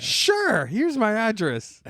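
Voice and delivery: high, slow, innocent voice; squeaky trusting kid; naive kid voice. Retold or imitated. Acting out naive kid reply.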